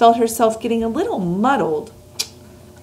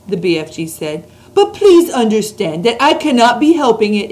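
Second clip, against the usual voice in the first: BFG giant voice; 3 semitones higher, 5 dB louder than usual. Acted. Reads the BFG's lines.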